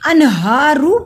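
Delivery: अनहारू with a clear n and no nasal hold; loud recitation, long हा in अनहारू; izhar. Shown as a demonstration. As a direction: sound the n of अनहारू cleanly, then stretch the aa. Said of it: "इजहार होता है कि इख्फा नहीं हो रहा".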